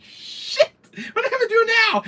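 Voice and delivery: high, loud mock-desperate cry; hammed-up desperate outburst; acted panic. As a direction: wail it like a cornered player. Acts out panic at the chase.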